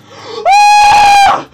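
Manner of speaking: very high, loud startled scream; a real fright shriek; scared scream. Real, not acted. Gamer screams at a near-fall on platforming. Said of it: "I'm getting so scared".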